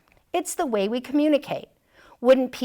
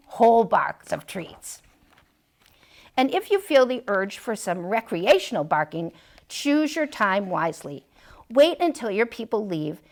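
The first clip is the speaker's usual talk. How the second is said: ordinary delivery, nothing marked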